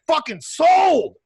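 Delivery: loud, slow, high shout of approval; thrilled shouted fucking sold; excited yes. Retold or imitated. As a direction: bellow it like you're sold. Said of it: "I'll be like".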